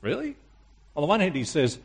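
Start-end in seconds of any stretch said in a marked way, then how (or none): 0.0-0.4 a softer high doubting question; lightly voiced disbelief, pitched up; incredulous really. Preacher echoing listeners' doubt, quietly incredulous. Incredulous echo of Jesus' words.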